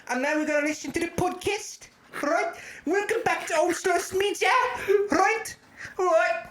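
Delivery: put-on high, bouncy South African accent; hammed-up South African host intro; over-the-top South African podcast voice. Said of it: "That's a South African accent".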